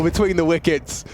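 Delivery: ordinary talk, nothing marked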